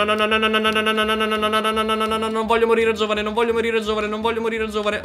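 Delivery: normal talking voice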